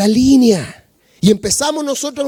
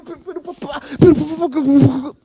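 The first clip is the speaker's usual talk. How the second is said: halting, stuttering son; stammering son; faster than usual. Retold or imitated. Son stammers as his father hugs him, halting. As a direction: trip over the words like a guilty son. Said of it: "el hijo probablemente balbuceaba".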